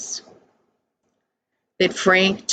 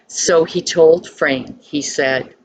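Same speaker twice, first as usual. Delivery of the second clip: usual speaking voice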